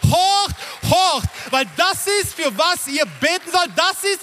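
high, impassioned urgency; fervent preacher's climax; God exhorting angels. Acted. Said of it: "dann sagt Gott zu seinen Engeln".

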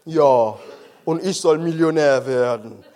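low, dry mocking reply; sarcastic retort. Pastor mocking the stuttering deacon's dream, low sarcasm.

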